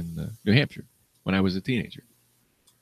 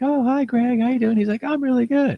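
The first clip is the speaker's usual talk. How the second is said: mock chirpy greeting. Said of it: "And he'd be like".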